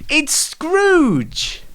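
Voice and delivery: big rising host reveal; showman's reveal of the guest; grand announcement. Acted. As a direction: announce the star guest with a flourish.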